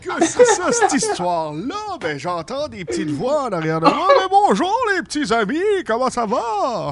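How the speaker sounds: drawn-out, swooping greetings; jolly Santa Claus. Acted. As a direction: Santa hailing his little friends, laughing. Plays Santa greeting little friends.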